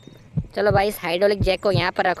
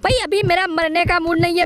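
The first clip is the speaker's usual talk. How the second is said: much higher panicky plea; panicked; 9 semitones higher than usual. Real, not acted. Gamer begging Granny to stay away, high panicked squeal. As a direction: squeal as the enemy closes in. Granny catches him again.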